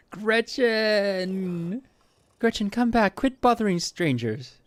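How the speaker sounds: high, drawn-out call to a runaway dog. Acted. Acted owner calling back the dog.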